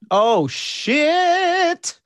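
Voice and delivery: high, loud, long-held "shit"; excited exclamation. Real, not acted. Listener reacting to co-host's news, excited exclamation. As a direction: let it ring out, stretched and high.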